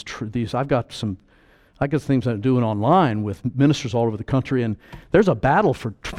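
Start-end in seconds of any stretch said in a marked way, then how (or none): none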